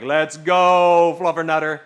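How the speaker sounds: loud hype cheer; retold loud excited cheer. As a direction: cheer it like a win. Cheering friend's fluff.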